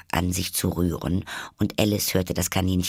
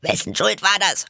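hohe Tierstimme; 12 semitones higher, 4 dB louder than usual. Acted. Tiere streiten.

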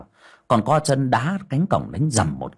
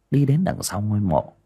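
ordinary delivery

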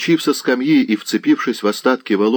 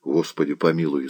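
ordinary delivery

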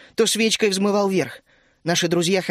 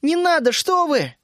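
high, urgent begging; pleading; 10 semitones higher, 3 dB louder, slower than usual. A narrator's reading. Вишня begging Тереза to stop, pleading. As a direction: implore in a high voice. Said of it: "взмолилась Вишня".

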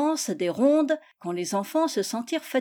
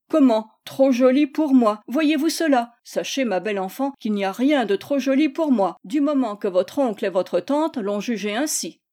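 louder mock-offended exclamation, then easing; indignant old friend; 3 semitones higher, 4 dB louder than usual. Tocambel answers Gisèle's slight, indignant. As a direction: act affronted, storybook style.

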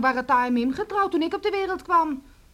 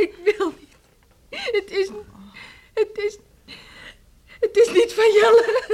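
crying, high and much louder on the last words; sobbing confession; 6 semitones higher, 7 dB louder than usual. Acted. Daughter sobbing to her parents that the child is not Jelle's. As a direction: blurt it out through sobs.

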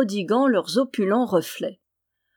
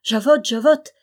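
louder call to a servant; raised, urgent calling voice; 3 semitones higher, 7 dB louder than usual. A narrator's reading. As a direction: summon the maid from another room. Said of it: "appela-t-elle".

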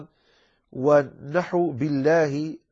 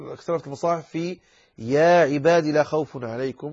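usual speaking voice

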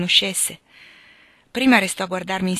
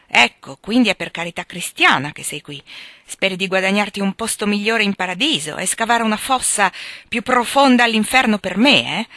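mocking, heated jab; bitter sarcasm; 3 semitones higher, 6 dB louder than usual. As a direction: throw their charity back at them. Sick man bitterly mocks her charity.

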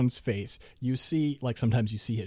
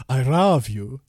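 mock stiff dubbed delivery, a touch louder; awful dub line reading; 7 dB louder, slower than usual. S1 mocking the dub's repeated line, mock stiff and louder. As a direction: parrot the grating dub take. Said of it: "it's awful".

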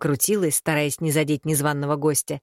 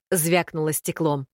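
usual speaking voice

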